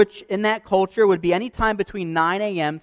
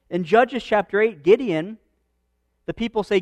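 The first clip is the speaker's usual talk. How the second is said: ordinary delivery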